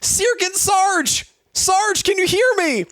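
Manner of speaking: very high, strained acted call; urgent calling out. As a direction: call a knocked-out comrade in a hammed-up high voice. Comrade calls to unconscious sergeant.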